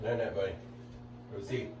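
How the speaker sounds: gentle hushed bedtime voice. Real, not acted. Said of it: "Still doing that dad thing".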